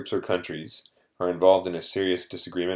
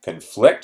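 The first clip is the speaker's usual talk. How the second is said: "conflict" with FLICT punched louder; stress on second syllable; 8 dB louder than usual. Shown as a demonstration. Teacher points out the different stress, hitting the FLICT of "conflict" hard. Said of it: "stress on the second syllable".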